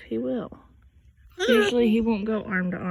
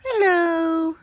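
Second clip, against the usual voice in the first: high sing-song hello; 8 semitones higher, 7 dB louder than usual. Real, not acted. Prompting the parrot to talk.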